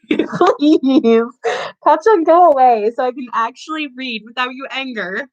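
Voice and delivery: laughing plea to the cat; half-laughing, begging; pleading. Real, not acted. Pleading with an annoying pet.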